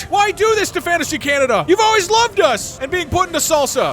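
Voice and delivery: high, theatrical royal appeal; hammed-up kingly dismay; pleading king. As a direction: plead with them as the wounded king. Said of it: "And the king, he says".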